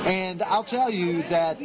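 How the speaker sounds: ordinary delivery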